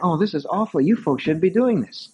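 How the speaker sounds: lightly voiced fretting wife. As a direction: protest over and over, embarrassed. Wife's protest voiced in story.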